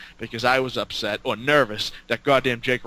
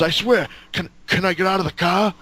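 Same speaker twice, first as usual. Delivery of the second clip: higher, pleading question that rises; mock pleading Jake; 6 semitones higher than usual. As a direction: beg sheepishly to step out. Jake begs to get out.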